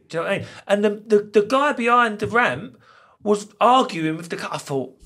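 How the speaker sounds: normal talking voice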